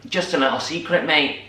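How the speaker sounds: a lightly voiced Manchester fox, tacking on mate; Manchester accent. Retelling the actor's Manchester twist, lightly imitated Mancunian accent. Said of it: "he's doing, like, a Manchester accent".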